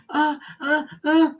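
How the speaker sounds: high, mock sex moan. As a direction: ham up the boss's moans. Replay of moaning.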